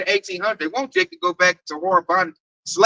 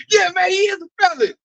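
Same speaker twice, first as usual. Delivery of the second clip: high, riled-up crowd agreement; rallying cheer; 8 semitones higher than usual. Retold or imitated. Coworkers rally behind the felon remark, mock rallying cry.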